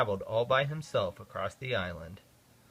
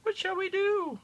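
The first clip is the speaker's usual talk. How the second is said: much higher, small worried voice; worried passengers. A narrator's reading. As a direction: ask it like a fretting crowd. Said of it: "said the people".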